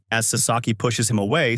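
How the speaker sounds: ordinary delivery, nothing marked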